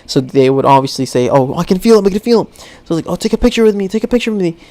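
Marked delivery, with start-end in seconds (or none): 1.3-2.5 mock alarm. Acts out pranksters' fake alarm. 3.1-4.6 mock excitement. Acts out pranksters asking for photo.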